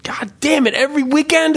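ordinary talk

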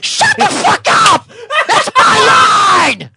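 very high, loud, slow scream; enraged at being interrupted; furious shout. Real, not acted. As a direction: scream at the heckler.

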